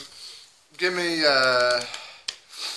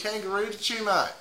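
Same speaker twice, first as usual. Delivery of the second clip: higher Australian lilt; put-on Aussie mate; Aussie accent; 7 semitones higher than usual. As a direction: answer in a playful Australian accent. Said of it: "run-of-the-mill Aussie".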